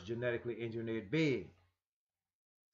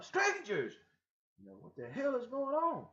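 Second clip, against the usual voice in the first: much higher alarmed voice; shaken; 12 semitones higher than usual. Acted. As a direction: panic at the visitors. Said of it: "he was shaken up".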